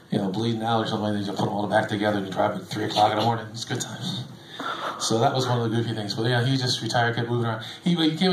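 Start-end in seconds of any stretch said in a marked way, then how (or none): 3.5-4.2 much softer wry whisper; whispered aside. Real, not acted. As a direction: breathe it out as a dry aside. Wry aside after grim story.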